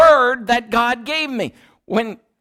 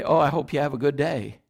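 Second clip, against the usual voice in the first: casual everyday hope; 6 semitones lower, 5 dB quieter than usual. Retold or imitated. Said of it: "the way we use it today".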